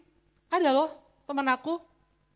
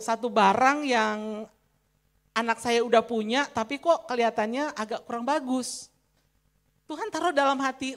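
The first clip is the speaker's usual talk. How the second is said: normal talking voice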